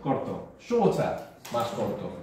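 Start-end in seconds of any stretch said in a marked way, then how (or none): 0.6-1.2 "Shorter" said high and falling; high falling model. Shown as a demonstration. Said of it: "¿Qué hemos hecho?".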